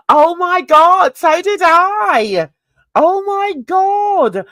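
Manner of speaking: high, drawn-out exclamation; excited recognition. Real, not acted. Streamer finding a viewer watched the same channels, excited. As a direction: gush slowly at a shared memory. Delighted at shared viewing memory.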